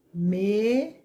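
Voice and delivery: "mesa" as long rising "me", long pause, "za"; split into syllables. Shown as a demonstration. Teacher finding household words with children, "mesa" syllable by syllable. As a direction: stretch "me", wait, then separate "za".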